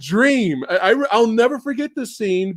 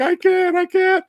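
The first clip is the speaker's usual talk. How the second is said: put-on weak kid quote; 10 semitones higher than usual. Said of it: "he's like".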